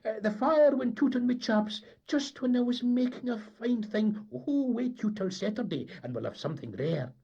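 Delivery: high, loud, indignant Scots deckhand; gruff Scots sailor. Acted. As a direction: bluster it like a Highland tar. Tar's excuse.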